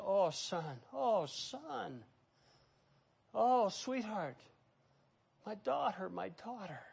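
put-on a parent aching over a child, gently; tender parent. Parent pleading with a wayward child, softly tender. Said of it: "like a parent saying".

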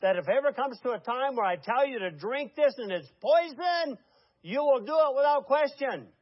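forceful cult-leader command; preacher's demand. Retold or imitated. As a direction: voice the cult leader urging total trust. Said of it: "and he said".

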